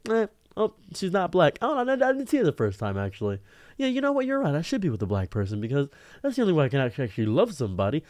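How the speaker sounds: high-pitched, exaggerated fake realization; sarcastic mock agreement. Man mockingly pretending to agree with his critic, sarcastic. Sarcastically acting out leaving her.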